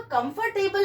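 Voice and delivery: कंफर्टेबल as "com-fur-tay-bul"; wrong pronunciation. Shown as a demonstration. Teacher shows what often comes out, wrong pronunciation. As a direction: sound the "or" in कंफर्टेबल. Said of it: "we mispronounce this".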